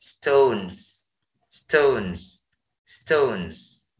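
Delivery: stones said slowly and softly with a z ending; drilling stones; repeated demo of stones. Shown as a demonstration. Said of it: "dibacanya stones".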